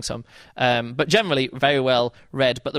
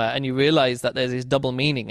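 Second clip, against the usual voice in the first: ordinary delivery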